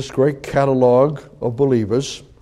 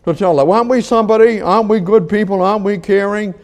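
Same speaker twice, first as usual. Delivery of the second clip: higher, louder, indignant challenge; indignant critics; 6 semitones higher, 4 dB louder than usual. Retold or imitated. Preacher voicing Paul's critics, indignant and raised. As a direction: voice the offended critics pushing back. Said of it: "Paul says".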